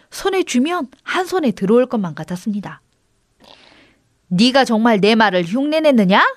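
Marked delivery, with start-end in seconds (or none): none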